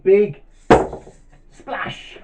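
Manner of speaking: unvoiced hissing sound-effect word; big splash. Storyteller as butterfly lands in a puddle, whooshing splash effect. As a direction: burst it out as a watery splash.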